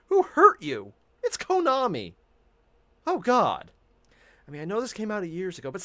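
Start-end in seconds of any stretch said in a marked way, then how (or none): 0.1-3.7 high, loud incredulous outcry; exasperated dismay. Real, not acted. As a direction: protest in pained disbelief, voice raised. Dismayed reaction to thanks for Konami.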